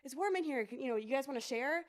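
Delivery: lightly voiced friendly forest animals; cheery visiting animals. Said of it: "they're like".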